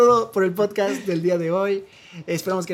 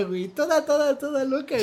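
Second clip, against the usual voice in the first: ordinary delivery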